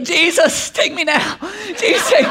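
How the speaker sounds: high-pitched mock plea to heaven; hammed-up desperate pleading; exhausted daughter. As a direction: imitate a kid dramatically begging for rescue. Voicing daughter's comic exhausted pleas.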